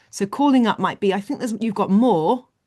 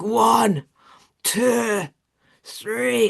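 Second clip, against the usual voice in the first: straining lifter; 3 semitones higher, much slower than usual. Acted. Acts James straining through lifts.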